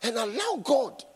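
loud, high rebuke from the pulpit; preaching at full force. Acted. Preacher telling critics to stop listening to nonsense, loud fiery preaching. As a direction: thunder it at the doubters.

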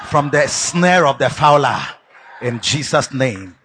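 low, hushed pastoral blessing; gentle closing prayer. Real, not acted. Closing prayer over congregation.

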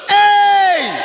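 long, high, full-force holler; shout. Shown as a demonstration. Preacher showing church how to react to a no, drawn-out shout. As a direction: shout it out and hold it.

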